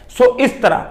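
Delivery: usual speaking voice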